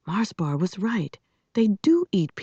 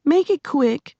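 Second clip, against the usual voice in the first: high, impatient growl; 9 semitones higher, 3 dB louder than usual. A narrator's reading. Cross girl answering a boy's question, growling impatiently. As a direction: cut him short crossly, pitched up. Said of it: "she growled".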